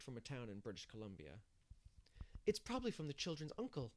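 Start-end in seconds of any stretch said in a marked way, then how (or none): 2.5-3.9 mildly excited, higher pitch; excitedly. A narrator's reading. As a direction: share a hopeful guess with some eagerness. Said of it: "she said excitedly".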